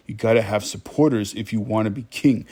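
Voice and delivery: normal talking voice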